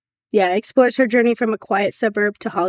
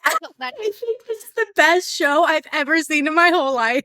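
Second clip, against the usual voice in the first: laughing; 7 semitones higher than usual. Real, not acted. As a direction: gush through giggles. Gushing about favourite show.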